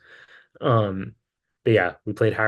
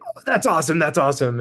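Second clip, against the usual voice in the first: imitating an excited fan, higher pitch; stoked fan; 5 semitones higher, faster than usual. Excited fan talking to the band before the show, stoked. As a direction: gush like a thrilled showgoer. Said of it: "he's, like, so stoked".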